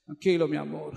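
calm him like a loving wife; wife soothing her crying husband, tender and soft. Retold or imitated.

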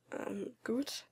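softly, barely audible; hesitant, hushed reply; quiet answer. A narrator's reading. Boy answering his worried friend quietly. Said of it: "antwortete ich leise".

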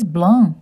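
blanc with nasal vowel, no c; French pronunciation. Shown as a demonstration. Teaching children blanc.

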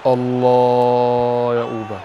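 slow, loud excited praise for the player; drawn-out cheer. Real, not acted. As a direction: draw out the praise slowly. Cheering a goal in game.

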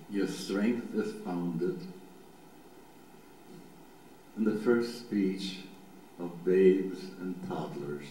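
low, meditative reading with long pauses; slow psalm reading. Shown as a demonstration. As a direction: read it slowly so each image can form. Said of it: "I'm gonna do it nice and slow".

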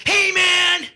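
loud high furious yell; hammed-up angry rock star; angry shout. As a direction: clear them off the stage.

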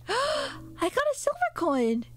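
delighted gasp over a silver coin; excited gasp. Real, not acted. Finds a coin in game.

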